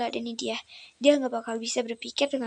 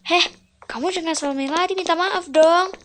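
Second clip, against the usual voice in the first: loud, higher, demanding yell; louder and louder demand for an apology; angry demand; 5 semitones higher, 10 dB louder than usual. Acted. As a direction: shout at him to apologise.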